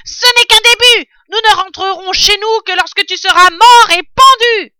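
très aigu et très fort, rageur; vocifère sa menace de mort; menace hurlée. Acted. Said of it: "en hurlant dans le trou". (Bin hurle sa menace au renard)

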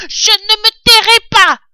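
high, furious rat yell; vociferating rat. A narrator's reading. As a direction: refuse loudly in a piercing little voice. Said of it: "vociférait le rat". (rat qui vocifère, aigu et furieux)

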